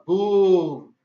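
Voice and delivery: loud, drawn-out boom; sudden booming burst; booming thunder word. Retold or imitated. Imitates the thunder in story.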